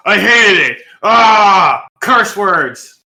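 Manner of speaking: loud, ranting critic; Frankie. Retold or imitated. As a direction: shout the rant. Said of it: "recreate what our old co-host Frankie probably would have thought".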